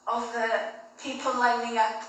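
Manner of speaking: ordinary talk, nothing marked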